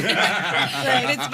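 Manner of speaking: laughing through the words; can barely talk for laughing. Real, not acted.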